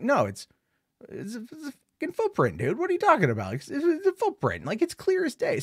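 high, squeaky-pitched incredulous protest; acted high-pitched exasperation; exasperated disbelief. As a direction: protest in a high voice, as if it were obvious. Acted exasperated reply to deniers.

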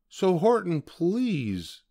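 high, pleading little mayor. A narrator's reading. Mayor begging Horton for help, pleading. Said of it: "pleaded that voice of the mayor's".

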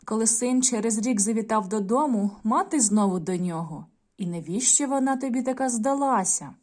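put-on storytelling voice for young pupils; story narration. A narrator's reading. Teacher reading a folk tale to the class, gently. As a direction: read the tale aloud to children.

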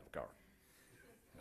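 hushed slow aside; slow confidential whisper. Real, not acted. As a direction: lean in and whisper it. Aside to the listeners.

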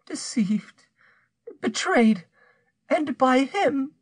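high voice, beginning in a whisper; stricken murmur. A narrator's reading. Bertrande learns of her husband's betrayal, stricken murmur. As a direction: murmur it haltingly, rising from a whisper. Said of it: "she murmured".

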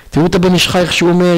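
usual speaking voice

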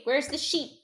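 usual speaking voice

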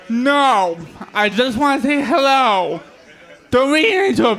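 ordinary talk

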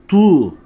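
tu starting halfway between t and d. Shown as a demonstration. Showing learners the in-between letter, thick tu. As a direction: blur the t of tu toward a thick d. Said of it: "ta ile da arası".

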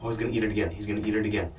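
usual speaking voice

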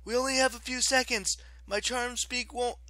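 high, urgent, strained warning; short of breath. A narrator's reading. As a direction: get the warning out between gasps. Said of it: "Piper gasped for breath".

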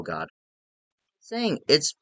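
ordinary talk

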